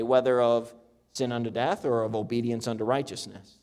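measured King James reading voice; preacher reading the Bible aloud to the congregation; scripture reading. Acted. Pastor reads Romans to his church, steady and measured.

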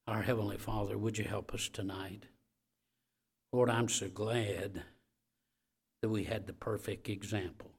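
hushed pastoral prayer; closing prayer. Real, not acted. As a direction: pray it gently over the room.

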